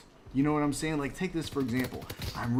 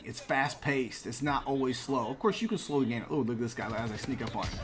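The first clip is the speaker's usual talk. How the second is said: ordinary talk, nothing marked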